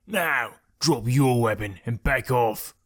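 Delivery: slow, menacing bandit leader; slow threat from a thug. A narrator's reading.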